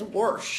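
worsh said slowly, with an r slipped in: "worsh"; Southern worsh. Retold or imitated. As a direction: drawl out "worsh" the Southern way, for wash. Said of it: "she says worsh".

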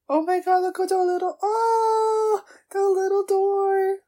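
high, loud squeal of adoration. Real, not acted. Viewer spotting the little door, loud squealing. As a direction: cry out over something adorable. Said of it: "I hope I'm not too loud".

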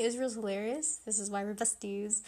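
usual speaking voice

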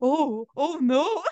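high, slow, imitated dread; mock scared. Elderly customer reacting to her threat, mock scared. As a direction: act out a worried old man backing off. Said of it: "now I'm scared".

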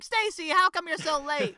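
hammed-up Goose scolding Stacy; imitating Goose. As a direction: squeal it like indignant Goose. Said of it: "Goose is like".

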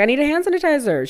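high, quick mom imitation; imitated mom. Mom repeats herself to her stunned child, high imitation.

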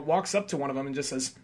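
ordinary talk

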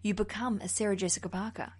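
usual speaking voice

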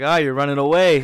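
loud, jeering quote; mock taunt. Knight taunting them as they leave, mock jeering shout. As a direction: call it after someone fleeing.

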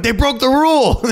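high-pitched, exaggerated protest; mock outrage. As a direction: cry foul like an indignant viewer. Retells outraged reaction to camera cut.

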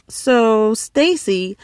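plain reading voice

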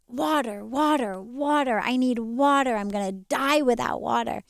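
a hammed-up fish begging for its life; desperate fish. Talking fish begs for water.

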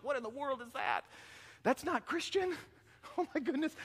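high-pitched, hushed shock at oneself; mock dismay. Churchgoer appalled by his own outburst, mock dismay. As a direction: squeak it high, as if scandalised.

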